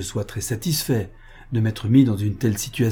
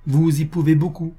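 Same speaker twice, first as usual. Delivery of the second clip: firm, higher-pitched statement; a measured show of resolve; 6 semitones higher, 4 dB louder than usual. A narrator's reading. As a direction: say it firmly, lightly lifted. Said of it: "ajouta d'un ton ferme".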